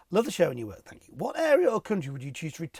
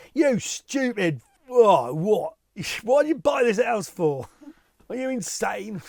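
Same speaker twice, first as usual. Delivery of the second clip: loud, slow exasperated telling-off, higher; mock outburst; 6 semitones higher, 8 dB louder, slower than usual. Presenter pretends to tell off a foolish buyer, loud mock rage. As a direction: berate him like he's lost his mind.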